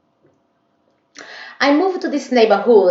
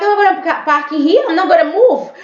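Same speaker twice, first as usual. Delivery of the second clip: defiant retort; 11 semitones higher, 4 dB louder, faster than usual. Retold or imitated. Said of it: "So I was like".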